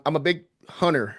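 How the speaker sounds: ordinary talk, nothing marked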